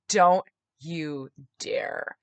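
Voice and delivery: very slow, word-by-word warning; drawn-out retold threat; stern warning. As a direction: warn mom off, spacing each word.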